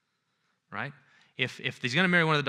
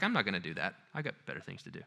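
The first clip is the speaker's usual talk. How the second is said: dry husband; 7 semitones lower, 8 dB quieter, faster than usual. Retold or imitated. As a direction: brush her off without effort. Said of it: "He's like".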